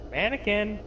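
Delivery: ordinary talk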